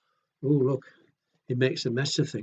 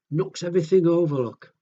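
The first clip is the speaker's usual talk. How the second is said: usual speaking voice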